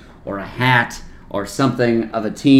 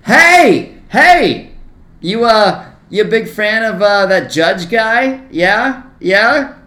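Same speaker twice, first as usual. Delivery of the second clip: high, loud goading questions; taunting ballpark heckle; heckling yell; 8 semitones higher, 6 dB louder, slower than usual. Retold or imitated. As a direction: holler at a rival fan to bait him.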